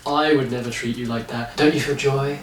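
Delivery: soft, hushed, creepy preacher voice; mock soft predatory preacher. Podcaster mocks the preacher to his co-host, soft and creepy.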